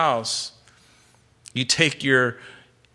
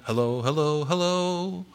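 light, playful chorus of replies, rising in pitch; quick echoed greetings, climbing higher at the end; several people answering; 3 semitones higher, 6 dB quieter than usual. Acted. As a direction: answer like one person after another. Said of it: "they would all respond".

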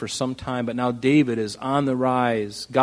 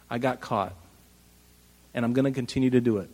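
unrepentant shrug; 3 semitones lower, 5 dB quieter, faster than usual. Retold or imitated. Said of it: "That's the attitude".

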